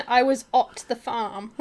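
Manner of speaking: "at" said as "ot", slowly; joking mispronunciation. Pretend. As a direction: drawl out "at" with the vowel of hot. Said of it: "You don't pronounce it ot".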